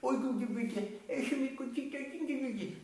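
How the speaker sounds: high mock baby-talk cooing. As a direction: coo at a baby in sing-song. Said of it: "vienen a su bebito y ¿qué hacen?".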